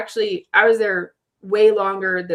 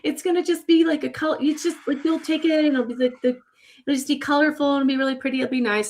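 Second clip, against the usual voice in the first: higher, breezy friends promising a pretty trip; reassuring friends; 7 semitones higher, faster than usual. Retold or imitated. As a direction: play the carefree friend talking her into it. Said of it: "they're like, they're like".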